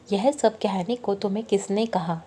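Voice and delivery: plain reading voice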